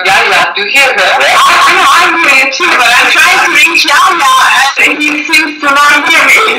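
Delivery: half-laughing, shouting to get through; shouting over echo. Real, not acted. Caller shouting over an echoing line to reach Yahya, loud and high.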